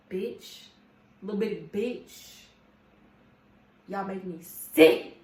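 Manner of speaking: loud, slow exasperated declaration; fed up. Real, not acted. Telling viewers off about the boss, loud and fed up. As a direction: really let the disgust ring out.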